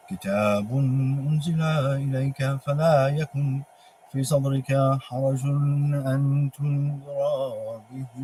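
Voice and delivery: slow, drawn-out Quranic chanting; Quran recitation. Acted. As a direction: recite it slowly like tajweed. Chants Quran verses slowly.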